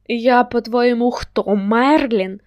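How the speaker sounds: loud, exasperated retort; irritated, rhetorical question with a drawn-out ending. A narrator's reading. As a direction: throw it back loudly, annoyed. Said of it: "роздратовано відповів йому".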